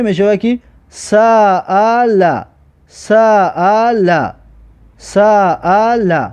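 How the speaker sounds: سأل stretched out very slowly, each syllable held; slow demo pronunciation. Shown as a demonstration. Teacher sounds out سأل very slowly for pupils. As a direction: model سأل slowly for students.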